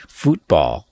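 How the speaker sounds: "football" said as foot-BALL with a long oo, "FOOT" rhyming with boot; futbol pronunciation. Shown as a demonstration. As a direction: draw out "football" as Spanish-style "fútbol". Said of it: "as in F U T".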